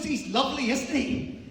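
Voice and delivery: loud, high, hammed-up enthusiasm; over-insistent gushing. Frightened walker to dog owner, over-insistent gushing. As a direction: gush at full volume, stretching the words.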